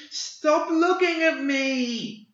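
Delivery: high, loud, drawn-out shriek of rage; tantrum shriek. Acted. Boy shrieking his rage as he bends reality, full tantrum scream. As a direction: let the tantrum explode in one long scream. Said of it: "He shrieked in a tantrum of rage".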